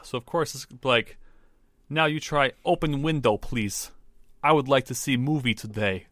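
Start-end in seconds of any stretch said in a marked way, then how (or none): 1.9-6.1 Russian accent. Shown as a demonstration. Co-host showing how Russians skip articles, Russian accent. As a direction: demonstrate a mock Russian accent. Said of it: "randomly skip articles".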